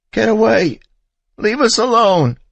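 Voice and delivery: very high, loud screaming; mother shrieking at a stranger to stay back; terrified shriek. A narrator's reading. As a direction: scream him away in panic.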